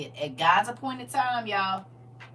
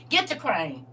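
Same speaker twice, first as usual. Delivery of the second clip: ordinary talk, nothing marked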